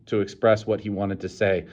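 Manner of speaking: plain reading voice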